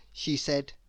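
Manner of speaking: usual speaking voice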